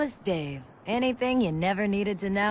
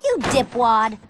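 louder, sharp put-down; girl scolding the guy who mocked her, loud and irritated; annoyed scold; 7 dB louder, slower than usual. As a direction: snap it at him. After being hit, scolding.

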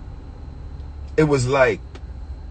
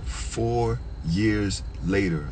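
"Four years later" drawn out, word by word; slow emphasis; 3 semitones lower, 6 dB quieter, much slower than usual. Real, not acted. Storyteller stressing the long wait, slow emphasis.

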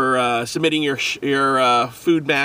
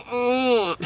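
robot voice; 7 semitones higher, 3 dB quieter than usual. Shown as a demonstration. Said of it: "Does that sound like a robot?".

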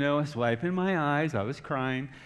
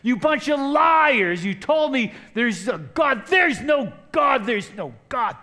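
much higher, louder, drawn-out raging accusation; slow furious shout; 9 semitones higher, 7 dB louder, slower than usual. Retold or imitated. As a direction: drag every word out in fury. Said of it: "I started shouting it".

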